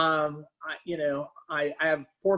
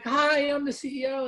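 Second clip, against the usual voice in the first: higher, playful mock greeting from the CEO; imitating a CEO; 9 semitones higher than usual. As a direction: chirp a cheery hello as the boss. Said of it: "He's like".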